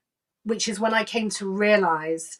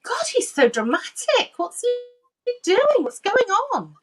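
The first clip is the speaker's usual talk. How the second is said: much higher, mock-exasperated eye-roll; waking up annoyed, high and slow; 13 semitones higher, 4 dB louder than usual. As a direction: scoff at the doctor's fuss. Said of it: "I remember thinking".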